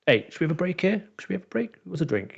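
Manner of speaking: sing-song it to smooth things over; chirpy host voice; mock cheerful offer. Imagined cheery break offer.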